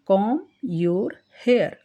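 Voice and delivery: each word said slowly and clearly; slow teaching read. Shown as a demonstration. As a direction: spell it out slowly for the class. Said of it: "comb नहीं बोलेंगे". (धीरे-धीरे साफ़ बोलकर)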